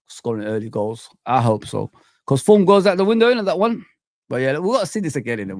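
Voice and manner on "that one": usual speaking voice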